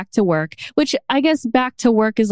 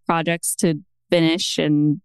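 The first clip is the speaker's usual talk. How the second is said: normal talking voice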